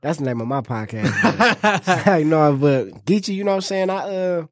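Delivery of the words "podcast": laughing. Real, not acted. Joking with a laugh.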